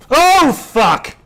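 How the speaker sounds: sudden loud, high curse of shock; real startled outburst; shocked shout. Real, not acted. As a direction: yell it as the bad news lands. Sees rival win the clue.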